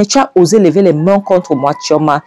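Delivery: acted high-pitched reproach; affronted quarrelling, voice lifted; indignant outrage. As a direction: accuse them of daring to hit you. Acted quarrel over the slap.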